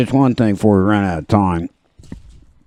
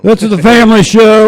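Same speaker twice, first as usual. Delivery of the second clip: mock scold; 11 semitones higher, 8 dB louder than usual. Real, not acted. Mock scolding cohost joke.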